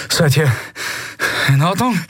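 winded, broken-up check on friends; panting. A narrator's reading. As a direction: gasp the question between breaths. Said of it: "keuchte Kai".

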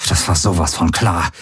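tense, mildly exasperated, teeth pressed together; through clenched teeth. A narrator's reading. Galen reacts to the enemy's arrival, through clenched teeth. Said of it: "stieß er mit zusammengepressten Zähnen hervor".

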